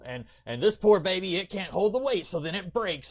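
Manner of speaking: oblivious explainer. Retold or imitated. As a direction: present it breezily, punched up. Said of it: "he's talking about it like".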